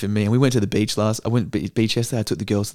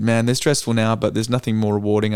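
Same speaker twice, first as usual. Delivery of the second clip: usual speaking voice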